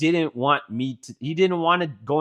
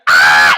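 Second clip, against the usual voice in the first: mock scream; 13 semitones lower, 14 dB louder than usual. Kid as the truck door starts closing, mock scream. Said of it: "he fucking screams again".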